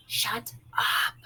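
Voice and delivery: sharp hushed stage whisper. A narrator's reading. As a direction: hiss it so Mama won't hear. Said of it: "Frida stage whispered".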